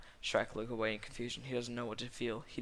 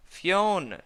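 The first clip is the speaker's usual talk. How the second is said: loud, high cry falling away; shouted call; 8 semitones higher, 11 dB louder than usual. A narrator's reading. Shrek calling out to his love, dramatic shout. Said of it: "shouts Shrek".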